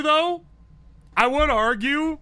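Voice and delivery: normal talking voice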